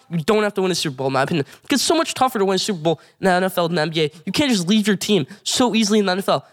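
normal talking voice

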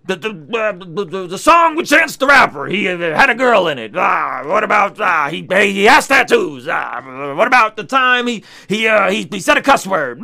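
loud mock whine of objections; shouted, stammering mock complaint; mocking critic. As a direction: caricature a sputtering, nitpicking fan. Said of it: "still gonna push back and say".

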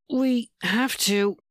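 slow, strained plea; strained, forced out. A narrator's reading. Man struggling for self-control tells lover, strained. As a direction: force the words out slowly. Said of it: "he got out".

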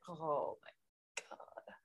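very slow, hushed groan of disbelief; exasperated whisper. Retold or imitated. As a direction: drag it out under your breath. Retelling her exasperated reaction to Rowan.

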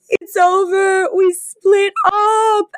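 hammed-up sobbing breakup news; mock sobbing. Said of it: "Fran is just sobbing".

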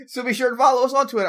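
fast, loud, high sign-off; mock podcast outro. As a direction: rush out a fake show outro. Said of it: "I'm kidding".

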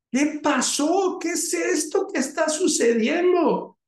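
high, astonished father asking; a startled dad who can't believe it; high surprised father's voice. Acted.

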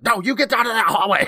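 hammed-up angry parent; mock parent yell. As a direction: shout the kid back down the hall. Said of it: "and I was like".

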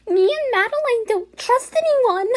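high and loud, wailing; high wailing quote. Shown as a demonstration. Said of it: "Why did this person just say".

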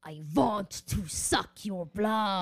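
slow drawn-out vampire voice; slowly loom over them like a vampire. Pretend. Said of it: "talk like a vampire".

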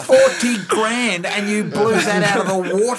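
laughing out the words in shock; laughing disbelief. Real, not acted. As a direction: crack up in disbelief at the sum.

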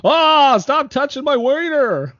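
high, loud startled cry; mock yell. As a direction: yelp it out in a high voice. Movie quote acted out.

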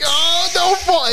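high, slow dramatic plea; mock plea. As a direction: drag it out like slow motion.

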